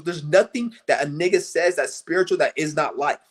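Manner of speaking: ordinary talk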